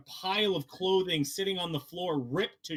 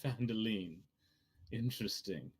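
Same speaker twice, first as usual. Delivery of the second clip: lower, measured voice of a scheming collector; mysterious NPC voice; 5 semitones lower than usual. Acted. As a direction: play him low and knowing, savoring each word. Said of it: "He turns to you and goes".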